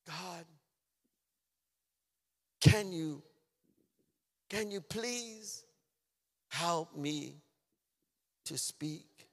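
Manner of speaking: pleading prayer in slow, separated phrases; retold prayer. Crying teenager to God about his speech, pleading. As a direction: plead haltingly, long pauses between the words. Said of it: "I said to myself".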